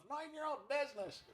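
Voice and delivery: high quiet huffy horse. Acted. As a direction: grumble like a cranky horse. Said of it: "his voice is really close to my normal voice".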